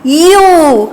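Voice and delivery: यू with a long, held "oo"; syllable demo. Shown as a demonstration. Teacher adding the vowel sign to य, यू drawn out. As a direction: stretch the vowel of यू. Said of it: "य पर लगाएंगे तो".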